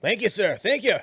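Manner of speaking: bright, loud grateful thanks; a cheery sailor thanking him; hearty sailor thanks. Acted. NPC sailors thanking Byron.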